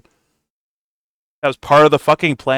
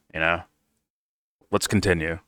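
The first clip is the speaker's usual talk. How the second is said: normal talking voice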